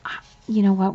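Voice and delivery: usual speaking voice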